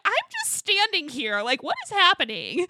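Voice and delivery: high, baffled protest; mock bewilderment. Imitating Gina being ganged up on, high mock bewilderment. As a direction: plead innocence as a hammed-up bystander.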